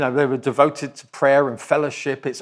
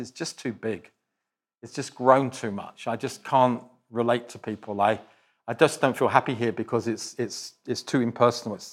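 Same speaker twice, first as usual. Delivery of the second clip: low, soft, understated dejection; subdued sad quote; 5 semitones lower, 7 dB quieter than usual. Retold or imitated. Departing member explains leaving to pastor, subdued and sad. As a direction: quietly voice someone's regretful excuse.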